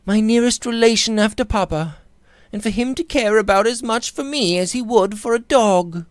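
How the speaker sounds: put-on a proud girl near tears, crying out; passionate young woman. A narrator's reading. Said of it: "she cried passionately".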